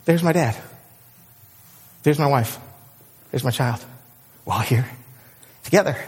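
soft, low wonder with long pauses; hushed, gentle reunion voice; tender recognition. Acted. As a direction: spot each loved one quietly, one by one. Imagined reunion with loved ones.

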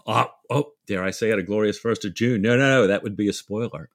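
usual speaking voice

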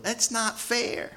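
put-on high, drawn-out little-kid protest; whining child. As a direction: stretch it out like a complaining child. Voicing himself as a little child.

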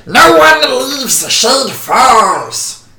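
drawn-out, commanding battle scream; villain's scream. Acted. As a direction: scream the order across a crowd. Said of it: "you can clearly hear him scream".

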